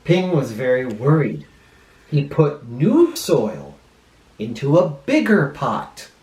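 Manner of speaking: put-on lively storybook voice for kids; reading to children. A narrator's reading. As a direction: read it like a children's librarian.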